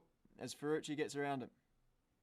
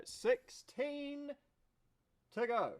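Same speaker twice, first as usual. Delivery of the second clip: "Sixteen" stretched out long and much higher; excited drawn-out count; 12 semitones higher, 5 dB louder, much slower than usual. Real, not acted. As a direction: savour the countdown like a chant.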